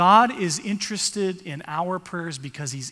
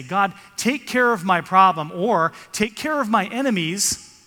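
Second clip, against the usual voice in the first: loud mock demand, pitched higher; demanding prayer; 4 semitones higher, 8 dB louder than usual. Preacher mimicking someone ordering God to fix things, loud mock demand. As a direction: bark the request at God like an order.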